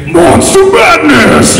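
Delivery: booming slow announcer voice, way up high; monster truck announcer. Retold or imitated. Imagining a truck rally announcer booming behind him. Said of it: "in my head, I hear".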